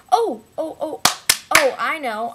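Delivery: high, loud eureka; sudden bright idea; excited. Real, not acted.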